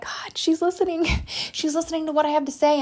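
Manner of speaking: high, laughing "she's listening"; a delighted rush of disbelief; thrilled realization. Retold or imitated. Mother realizing her daughter heard her, laughing excitedly. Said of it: "I was like".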